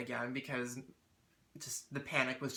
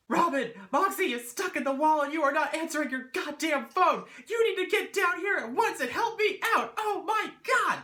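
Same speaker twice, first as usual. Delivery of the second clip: much higher, much louder panicked tirade; frantic yelling; 14 semitones higher, 10 dB louder than usual. Retold or imitated. Yelling at her mother to come help, frantic and furious. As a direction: scream down the phone in fury. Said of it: "I just lose my shit".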